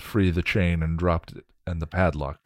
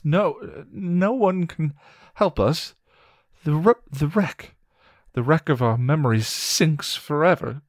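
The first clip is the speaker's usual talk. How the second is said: put-on much higher, stammering scared young man; frightened William; 9 semitones higher, 4 dB louder than usual. William begs Willow to leave before they come, frightened and stammering. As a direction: plead nervously, tripping over the words.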